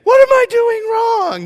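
high, loud comic outburst; mock wail. Upset in-law in a bad conversation, high mock wail.

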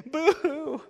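high mock boo-hoo through a laugh; fake crying. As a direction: tease a sob, laughing. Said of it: "I'm not making fun of anybody, my wife".

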